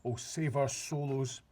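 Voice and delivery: slow, drawn-out anguish; anguished cry. A narrator's reading. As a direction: moan it in despair. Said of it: "filled with fury and anguish".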